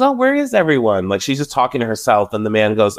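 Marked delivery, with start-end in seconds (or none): 0.0-1.1 much higher, airy imitation of a lost guest; high mock Erica voice. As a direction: squeak it out like she's talking to herself in an empty ballroom. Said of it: "she goes".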